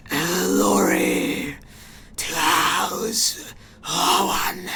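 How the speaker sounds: slow, whispery mechanical hiss. Acted. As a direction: hiss each word slowly like a machine priest. Keeps the tech priest voice.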